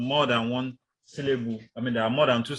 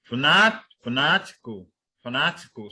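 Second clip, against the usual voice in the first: fanatical with NAT punched, hardest the first time; stress on second syllable; 3 semitones higher, 5 dB louder than usual. Shown as a demonstration. Teacher showing second-syllable stress, fanatical stressed on NAT. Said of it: "stress is on the second syllable".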